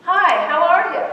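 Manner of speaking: high, loud passing hello; perfunctory polite greeting. Acted. Greeting someone in the hall, just polite. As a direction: call it out without waiting for an answer. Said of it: "We're just being polite".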